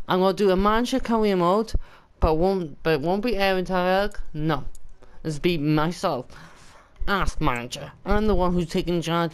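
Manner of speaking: normal talking voice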